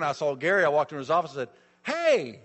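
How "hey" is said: loud, high retold "hey"; calling out greeting. Preacher greets a colleague in his office, calling out. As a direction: hail a colleague brightly from the doorway.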